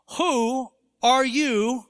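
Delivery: emphatic, weighty put-down with long pauses; solemn rebuke. Acted. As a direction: draw out each word in stern rebuke. Quotes Paul's rebuke of the objector.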